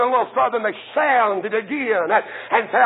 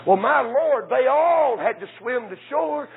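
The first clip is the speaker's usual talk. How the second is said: ordinary delivery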